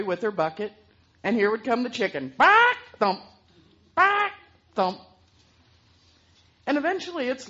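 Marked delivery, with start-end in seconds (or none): 2.4-5.0 high loud cluck and thump; limping clucking. Acted. Limping hen clucking and thumping along, high and loud. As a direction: cluck with a limp beat.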